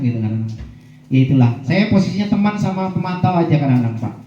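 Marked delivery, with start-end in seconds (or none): none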